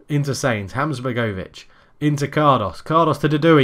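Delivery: brisk running match commentary; play-by-play commentary. Acted. Live football game commentary.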